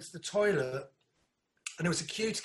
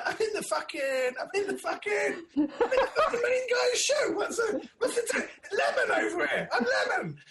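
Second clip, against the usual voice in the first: mock outrage; 14 semitones higher than usual. Retelling own indignant outburst.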